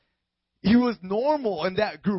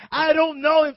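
high, loud shouted protest; vehement denial; 9 semitones higher, 9 dB louder than usual. Acted. Peter denying Jesus for the third time, vehement shout. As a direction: shout them off, insistent and high.

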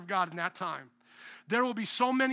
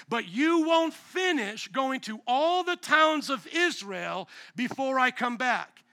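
slow, each word weighed; slow emphatic quote; 3 semitones higher, slower than usual. Real, not acted. Pastor quoting Jesus to the congregation, slow and weighty. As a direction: draw it out for emphasis.

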